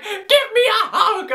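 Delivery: high, drawn-out fan squeal; excited shout. Retold or imitated. Running up to TV stars for a hug, excited shout. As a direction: shout it slowly, overcome with excitement.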